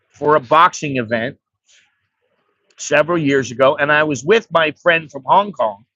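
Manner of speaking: normal talking voice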